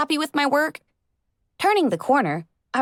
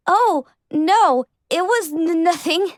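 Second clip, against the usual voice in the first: higher, stammering flustered denial; 7 semitones higher, 3 dB louder, slower than usual. Acted. Starstruck pony denying she was heartbroken, flustered and stammering. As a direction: trip over the words, caught out.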